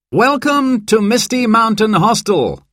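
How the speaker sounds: high-pitched greeting to a group; welcoming guide. A narrator's reading. Guide Dan welcomes the guests.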